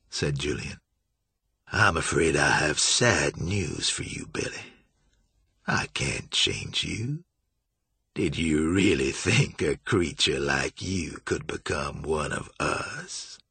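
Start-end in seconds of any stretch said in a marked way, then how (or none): 1.7-13.4 low, slow, silky contempt; cruel mock sympathy. A narrator's reading. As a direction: let him down with cold relish. Julian coldly rejects Billy.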